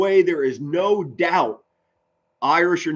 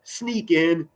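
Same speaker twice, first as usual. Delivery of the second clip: higher, lightly voiced doubtful fan; mock timid fan; 7 semitones higher than usual. Streamer mocking a fan who expects little, lightly voiced. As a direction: mimic a fan with no faith. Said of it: "You're not gonna say".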